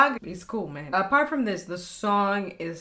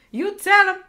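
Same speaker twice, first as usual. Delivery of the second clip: cheering on; 9 semitones higher, 10 dB louder than usual. Real, not acted. Cheering on the skit.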